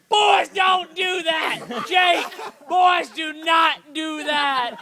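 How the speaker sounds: high, loud, slow outraged yell; screaming dad. Retold or imitated. Father catches son trimming pubes, outraged slow shout. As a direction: bellow it like a shocked father. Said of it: "my dad just started screaming".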